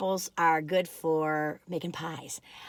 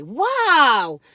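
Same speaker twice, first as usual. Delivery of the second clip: surprised; 4 semitones higher, 9 dB louder than usual. Real, not acted. Surprised at three apples done.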